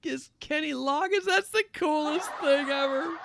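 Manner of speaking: high-pitched starstruck gushing; retelling his own giddy excitement; excited gush. Retold or imitated. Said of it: "I was like".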